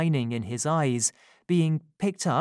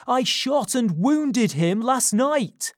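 slow, loud, higher proud shout; loud boast; 10 semitones higher, 5 dB louder, slower than usual. Acted. Giles boasting he shot the robber, slow loud shout. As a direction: shout it, leaning on wounded. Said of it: "he shouted loudly".

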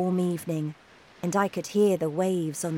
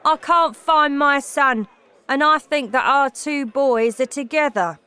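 loud, raised-pitch cross father; angry; 5 semitones higher, 6 dB louder than usual. A narrator's reading. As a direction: complain loudly and demand to know. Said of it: "looking very angry".

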